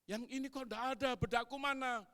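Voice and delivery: high, loud, fast accusing wife; shrill-free loud wife scolding; wife's angry complaint. Retold or imitated. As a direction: snap at husband, demanding where it is. Wife angrily searching for makeup.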